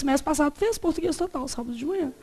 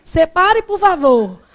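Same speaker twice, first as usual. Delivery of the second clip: loud, emphatic retold challenge; challenging demand; 3 semitones higher, 9 dB louder, much slower than usual. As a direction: demand it firmly, stretching the last word.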